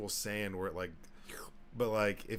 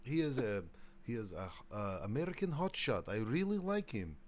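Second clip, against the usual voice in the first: comic Russian, pitched up; Russian accent; 4 semitones higher than usual. Acted. Russian fan praising an American hotshot, Russian accent. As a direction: admire him in a lilting Russian accent. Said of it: "My, my Russian accent".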